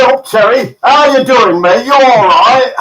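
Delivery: loud, high hearty Yorkshire greeting; mock Yorkshire accent. Cast member greeting Terry after dropping his American, loud Yorkshire imitation. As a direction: boom it out in broad Yorkshire. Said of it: "come up and talked to me and said".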